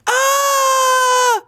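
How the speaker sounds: long, high, loud scream; mock panic scream.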